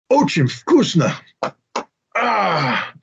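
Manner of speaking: slow, high, overdone praise in Russian; faking delight. Retold or imitated. Guest praising the cooks' fish omelet, faked delight. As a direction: pretend it is delicious. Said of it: "But I'm like".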